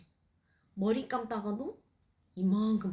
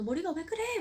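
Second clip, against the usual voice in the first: put-on high, wailing child voice; crying daughter; 10 semitones higher than usual. Daughter crying at mom's hair, high child wail. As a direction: wail like a frightened little girl. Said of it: "막 놀래가지고 우는데".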